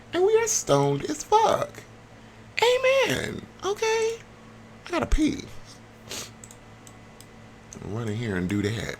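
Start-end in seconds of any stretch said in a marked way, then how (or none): 0.1-5.4 very high, slow squeaky character voice; squeaky sim voice. Acted. Voicing a stoned sim, very high and squeaky. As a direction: pitch way up into a cartoon squeak.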